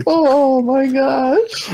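slow, high drawn-out oh my gosh; delighted gasp. Real, not acted. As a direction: stretch it out in delight. Stretched delighted exclaim.

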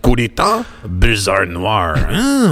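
slow, hammed-up French DJ voice; a French radio host's hammy drawl; comedy French accent. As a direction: purr the DJ names in a mock French accent. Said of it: "just go right into that voice".

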